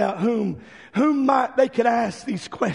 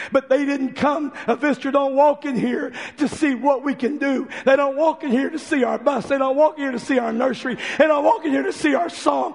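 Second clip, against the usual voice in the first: preaching at full force; 4 semitones higher than usual. Acted. Preacher building to the congregation, full preaching force. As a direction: drive the point home from the pulpit.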